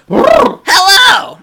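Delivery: booming hype announcer shout; fighting game announcer. Pretend. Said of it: "let me get my announcer voice going".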